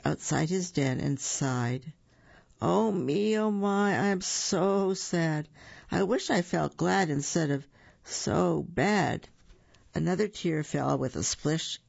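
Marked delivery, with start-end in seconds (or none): 2.6-9.3 higher, mopey bear voice, drawn out; woeful bear whimpering to himself; sad bear. Acted. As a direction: moan like a gloomy cartoon bear. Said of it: "tears that had dripped from his sad eyes".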